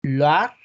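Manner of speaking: Loire said loar; French pronunciation. Shown as a demonstration. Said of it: "La región de Loira, o el valle de Loira, es". (Loire pronunciado loar)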